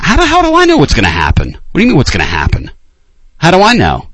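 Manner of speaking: high, fast exasperated outburst. Real, not acted. Answering a caller who keeps asking what happens, exasperated. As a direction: throw the question back, fed up.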